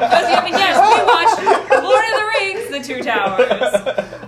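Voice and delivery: high, laughing through the words. Real, not acted. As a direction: laugh while you speak. Group bursts out laughing.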